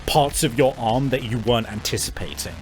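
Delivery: ordinary delivery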